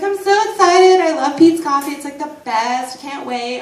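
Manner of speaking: a hint of a bubbly fan of the coffee; gushing customer. Imitating an excited customer.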